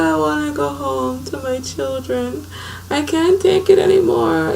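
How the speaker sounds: high, tearful pleading voice; crying plea. Retold or imitated. Mother retells crying to her sister in the car, tearful high plea. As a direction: beg to go home through tears. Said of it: "I started crying".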